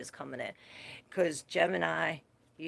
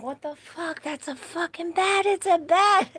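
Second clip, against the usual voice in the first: high, rising shriek building louder; retold panic; 12 semitones higher, 6 dB louder than usual. Woken at night by a bat, rising panic. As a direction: freak out at the bat in growing alarm. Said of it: "I'm like".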